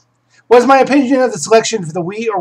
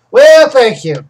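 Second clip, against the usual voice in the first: tag British voice; 5 semitones higher, 7 dB louder, slower than usual. Pretend. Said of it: "had to listen to my British".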